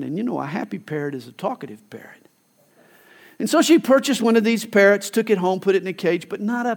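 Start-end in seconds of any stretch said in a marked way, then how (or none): 0.0-2.3 fast, hushed confiding whisper; whispered sales tip. Retold or imitated. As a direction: let her in on a secret. Store owner confides tip.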